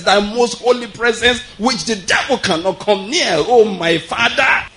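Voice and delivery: high, slow, booming preacher prayer; showy church prayer. Retold or imitated. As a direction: ham up a pompous prayer to impress the congregation. Mocking a showy microphone prayer.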